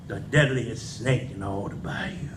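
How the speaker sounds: hushed, breathy warning about the snake; spooky whisper. Acted. Poet whispering to the crowd about the snake. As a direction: whisper it like a secret danger.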